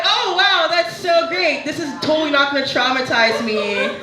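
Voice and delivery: high-pitched fake enthusiasm, easing downward; sarcastic delight. Young daughter reacting to mother's grim advice, high sarcastic cheer. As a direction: gush in a high, falsely pleased voice.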